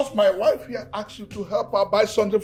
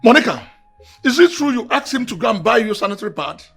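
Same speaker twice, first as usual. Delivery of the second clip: loud, higher-pitched demand; angry confrontation; 4 semitones higher, 6 dB louder than usual. Acted. Husband confronting his wife about the errand, angry confrontation. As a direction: demand an answer from her.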